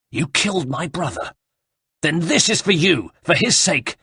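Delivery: high, strained outrage; vengeful anger. A narrator's reading. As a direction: vow revenge for a killed brother. Villain learns brother was killed, attacks.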